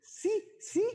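put-on high, eager little-girl yes; niña emocionada. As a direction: squeal yes like an excited child. (sí agudo de niña emocionada)

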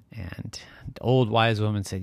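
ordinary delivery, nothing marked

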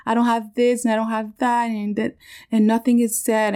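lightly acted, high upset wail; retold tearful upset. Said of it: "I started crying".

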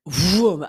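a loud rushing vroom sound; imitated whoosh. After unraveling the spell, releasing energy with a loud vroom. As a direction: let the energy burst out as a vroom. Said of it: "I went".

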